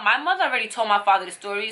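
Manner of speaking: ordinary talk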